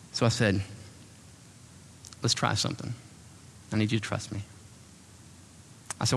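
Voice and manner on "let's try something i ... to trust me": reassuring. Retold or imitated. Pastor counseling distraught woman.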